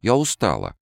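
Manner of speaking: petulant, whiny complaint; sulky, tired wife; capricious. Acted. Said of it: "жена закапризничала".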